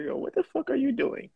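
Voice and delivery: high mock-wife questioning; imitating wife. Host retelling his wife catching him, imitated high voice. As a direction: quote the wife in a put-on high pitch. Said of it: "My wife over here really is like".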